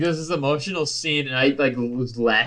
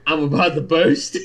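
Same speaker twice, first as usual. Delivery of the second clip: louder, through breaking laughter; laughing echo; 2 semitones higher, 5 dB louder than usual. Pretend. Podcast host echoing a friend's line, cracking up. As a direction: blurt it out while cracking up.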